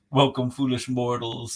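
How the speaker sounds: slow, ominous ghost host greeting; slow and menacing, like a spooky host; dark lord. Retold or imitated. Haunted Mansion host greeting.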